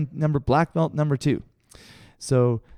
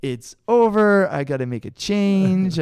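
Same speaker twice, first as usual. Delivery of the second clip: higher and louder, hammed-up dismay; mock panic; 7 semitones higher, 5 dB louder, slower than usual. Teased by the whole arena, hammed-up despair. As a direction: throw up your hands, it's all over.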